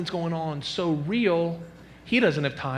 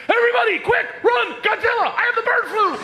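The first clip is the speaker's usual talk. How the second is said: high, loud frantic yelling; panicked shouting; 5 dB louder than usual. Retold or imitated. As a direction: scream a wild warning. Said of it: "He's going like".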